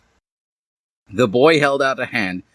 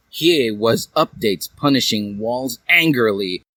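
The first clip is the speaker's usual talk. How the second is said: ordinary delivery, nothing marked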